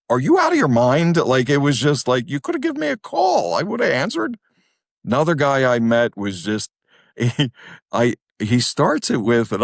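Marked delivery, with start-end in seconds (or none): 0.1-4.4 high-pitched exasperated disbelief; comic incredulous squeal of protest; retold incredulity. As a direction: replay it as a baffled protest. Retold incredulous reply to therapist.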